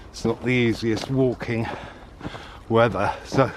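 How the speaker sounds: panting uphill; breathless, puffing between words; out of breath. Real, not acted. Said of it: "sorry for the panting".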